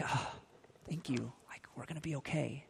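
acted whispery sigh of relief; relieved. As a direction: let go into a very soft, relieved murmur. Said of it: "I could see just relief".

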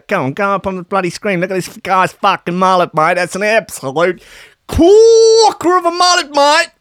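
loud, high Australian bloke whooping in awe; over-the-top Australian bloke cheer; hammed-up Aussie. Hammed-up Aussie admiring the mullet.

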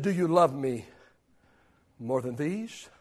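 low, hushed and gentle asking; soft questioning Jesus. Acted. Jesus gently asking Peter, hushed and tender. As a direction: ask it quietly, almost private.

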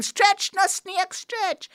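high, slow shouted cheer; crowd cheering. Acted. Animals at the party urging the snake on, shouting. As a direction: egg him on with a loud high chant.

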